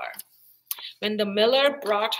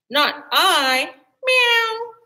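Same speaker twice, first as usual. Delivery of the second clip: high cat voice ending in a meow; 9 semitones higher, 7 dB louder, much slower than usual. A narrator's reading. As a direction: mew it like a cat. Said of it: "The cat said".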